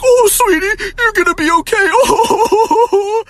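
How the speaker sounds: mock-soothing high mom voice. Mom reassuring son in psych ward, high slow mock coo. Mom while he's escorted.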